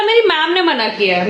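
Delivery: put-on a touch of a child's innocent excuse; mother playing her son's reply. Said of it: "तो बोला".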